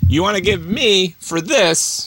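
a hint of a smug dealmaker; totally cool. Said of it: "He was totally cool. Like, he's like".

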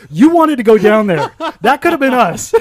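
loud, high-pitched accusing yell; appalled outburst. Retold or imitated. Zach turning on the friend who suggested the tracks, yelling. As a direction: yell it in outraged disbelief.

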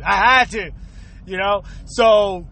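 ordinary delivery

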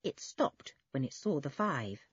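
usual speaking voice

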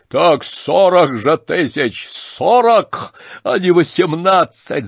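high, loud, slow shout. Acted. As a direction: bellow the new bid. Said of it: "закричал Рогожин".

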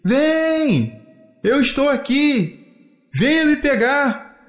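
high, slow, stretched call; playful sing-out from afar. A narrator's reading. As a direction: dare someone to come and catch you. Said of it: "O filho dizia".

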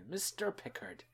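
high, hammed-up stage Irish accent; bad Irish accent. Podcaster mimicking the episode's bad Irish accent. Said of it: "More bad Irish accents".

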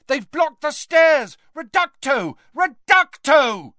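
high, loud alarmed shout. A narrator's reading. Fighter calls that the stairs are blocked, shouting. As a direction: shout it from below, high and urgent. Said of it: "and a voice shouted".

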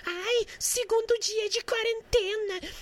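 high, slow, drawn-out whine; mocking whiny meme voice. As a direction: mimic a self-pitying complainer. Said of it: "aqueles memes de bosta".

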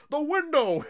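high, loud sudden cry; mock urgent shout. Retelling the dad spotting a way out, mock shout. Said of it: "Adam Scott just goes".

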